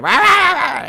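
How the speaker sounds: high, loud barked rah rah; mock barking yell. Brash New Yorkers yelling at each other on the street, loud mock bark. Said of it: "barking at each".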